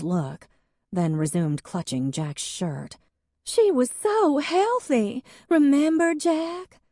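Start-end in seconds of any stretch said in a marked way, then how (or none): 3.4-6.8 high, louder, tearful wail; weeping Hadley. Acted. Hadley to Jack about her dead cat, weeping high. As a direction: wail it like the world has ended.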